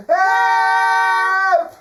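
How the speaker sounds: high, loud drawn-out cries of alarm; acted boy's urgent holler; yelling for help. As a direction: scream it down to the whole village. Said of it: "and yells down".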